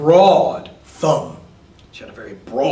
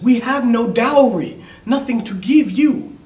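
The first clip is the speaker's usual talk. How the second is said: higher, louder worried mother; 9 semitones higher, 6 dB louder than usual. A narrator's reading. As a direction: protest as the anxious mother. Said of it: "of course, were worried".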